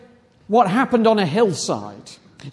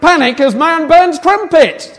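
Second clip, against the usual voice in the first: headline announcement; 4 semitones higher, 6 dB louder than usual. Acted. Reading headline after fanfare.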